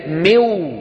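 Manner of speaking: "مو" drawn out as miw; isolated awkward syllable. Shown as a demonstration. Teacher has students examine the syllable, "مو" stretched as miw. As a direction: hold the waw after kasra in "مو". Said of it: "تأملوا كلمة مو".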